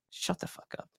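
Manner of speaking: sigh, then whisper it fed up; a breathy, done-with-it whisper under the breath; exasperated whisper. Real, not acted. Exasperated aside after long rant.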